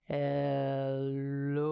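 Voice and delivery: deep "Hello" from the belly; deep belly "Hello" in a kids' song; low hello. Shown as a demonstration. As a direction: say "Hello" as low as you can. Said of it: "as low as we can".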